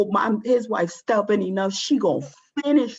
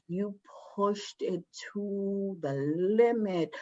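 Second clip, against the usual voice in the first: inner voice; 7 dB quieter than usual. Retold or imitated. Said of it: "And I was like".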